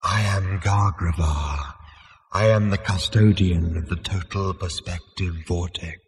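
slow, ethereal disembodied voice; drawn-out ghostly self-introduction; Gargravar. A narrator's reading. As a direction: intone it like a solemn spirit.

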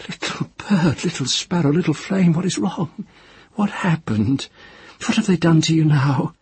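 high, pleading and tender; a frightened woman coaxing a hidden child; high-voiced tender pleading to a child; tender frightened mother. Tenar tenderly calling the child.

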